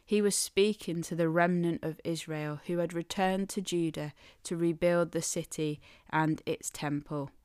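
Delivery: ordinary talk, nothing marked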